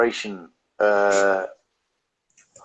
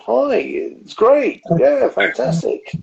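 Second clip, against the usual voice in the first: high, slow, mock-cheery greeting; sing-song fake enthusiasm; mimicking chirpy host; 12 semitones higher, slower than usual. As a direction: ham up a forced happy welcome. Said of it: "you're always in the same".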